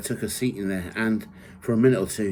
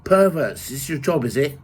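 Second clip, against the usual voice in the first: higher, louder mocking yell; yelled taunt; 6 semitones higher, 6 dB louder than usual. A narrator's reading. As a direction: shout it at the nurses in fury. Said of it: "I yelled and shouted".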